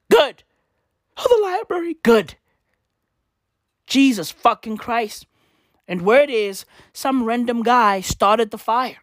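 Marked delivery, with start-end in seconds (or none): none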